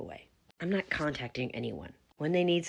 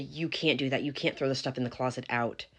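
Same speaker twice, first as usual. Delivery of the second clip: ordinary talk, nothing marked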